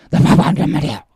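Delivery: put-on a child's loud, offended protest; angry retort. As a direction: snap back in a huff, denying it hotly. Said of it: "화를 내면서".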